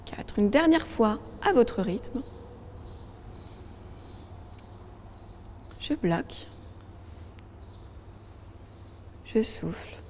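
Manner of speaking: calm, steady counting of breaths; guided breathing. Acted. Leads box breathing count. (exercice de respiration guidée, voix calme)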